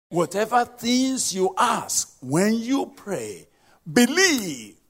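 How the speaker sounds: slow, loud, high-pitched proclamation; emphatic scripture reading. Shown as a demonstration. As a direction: drive each phrase home, building to a ringing peak. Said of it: "the way I'm reading it, it's so emphatic".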